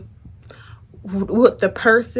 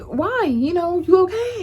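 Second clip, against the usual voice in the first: high, alarmed concern; retold shocked worry; worried high questions; 9 semitones higher, 3 dB louder than usual. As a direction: ask like you're scared for him.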